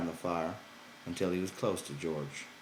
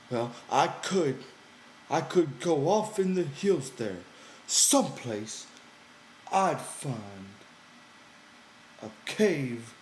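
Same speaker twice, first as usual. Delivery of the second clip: Lenny pouting, higher and louder; high, sulky simple man; 7 semitones higher, 5 dB louder, slower than usual. Acted. Lenny threatening to go live in a cave, high and sulky. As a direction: push it up high with a hurt edge.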